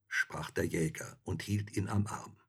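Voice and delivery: plain reading voice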